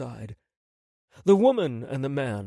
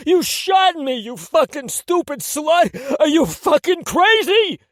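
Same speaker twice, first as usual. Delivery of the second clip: much higher, loud anguished yell; yelling in pain; 10 dB louder than usual. Acted. Tony yells at Catalina after being shot, pained yell. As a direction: howl at her after the shot. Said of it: "Tony yelled out".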